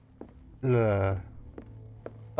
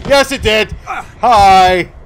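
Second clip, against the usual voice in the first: high, loud yelp; startled yell; 7 semitones higher, 13 dB louder, much slower than usual. Real, not acted. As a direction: shout it out, caught by surprise. Jumpscare, yelling at the monster.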